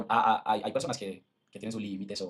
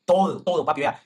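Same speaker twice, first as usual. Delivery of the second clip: higher, louder insistence; emphatic outburst; 5 semitones higher, 7 dB louder, slower than usual. Real, not acted. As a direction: hammer the point home. Heated insistence to his audience. (arrebato enfático, más alto y fuerte)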